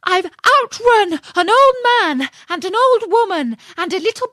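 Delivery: high, loud storybook shriek; screamed out. A narrator's reading. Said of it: "screamed out".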